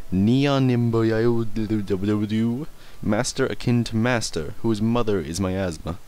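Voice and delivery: usual speaking voice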